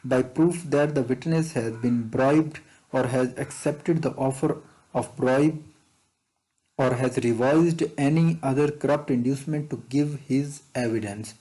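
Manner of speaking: slow, deliberate reading of an exam question. Acted. Teacher reading an exam question to students, slow and deliberate. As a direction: read it out word by word for the class. Reads MCQ question slowly.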